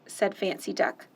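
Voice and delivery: plain reading voice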